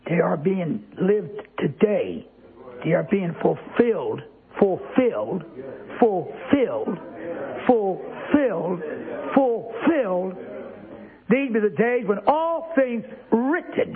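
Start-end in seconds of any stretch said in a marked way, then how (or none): 0.1-10.4 fulfilled repeated, slow and falling; slow emphatic repetition. Acted. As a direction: hammer the word fulfilled, slower each time. Preacher repeating fulfilled slowly.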